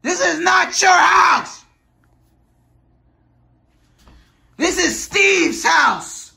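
high furious yelling; angry shout. Acted. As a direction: yell it, high and outraged. Angry shouting match in house.

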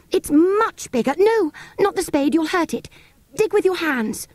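high, eager plea; high urgent girl. Acted. Girl begs brothers to dig by hand, high urgent. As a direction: urge them to dig by hand.

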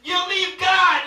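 high, loud preaching with stretched final words. Real, not acted. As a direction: drive it home to the congregation.

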